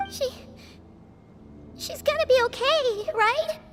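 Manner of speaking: very high, hesitant little voice; falter, then seek reassurance in a tiny high voice; worried pleading. Acted. Worried about fallen companion.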